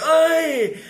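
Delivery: put-on high, drawn-out Glasgow accent; Billy Connolly. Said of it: "he's got this Glasgow accent".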